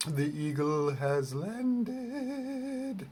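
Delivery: high, slow, drawn-out singsong; sing-songy voice. Retold or imitated. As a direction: stretch it out in a lilting sing-song. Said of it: "in his little sing-songy voice".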